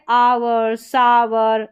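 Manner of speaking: आवर, सावर slowly in two syllables; OUR as "ow-er". Shown as a demonstration. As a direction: stretch आवर and सावर as "ah-wer". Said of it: "आउ, अ या उ".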